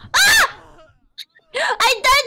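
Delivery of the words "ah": scream. Real, not acted. Dying in game.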